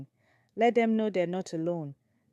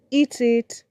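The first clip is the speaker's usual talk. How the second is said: loud, higher harsh order; cold command; 5 semitones higher, 7 dB louder than usual. Acted. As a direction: snap the order at her. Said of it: "replaced by cold command".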